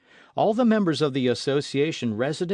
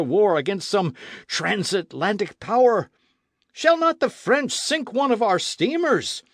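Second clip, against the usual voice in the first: impassioned rant, louder and climbing higher; a warmonger pleading for one more war; fiery old gunner; 5 semitones higher, 5 dB louder than usual. Acted. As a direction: veteran artillerist ranting, louder.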